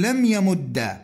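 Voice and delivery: "يمد" ending in a short "a", yamudda; fatha ending. Shown as a demonstration. Teacher showing students the lightest vowel, "يمد" ending in fatha. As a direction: close "يمد" on a doubled d plus "a". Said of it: "الفتح يجوز أخف الحركات".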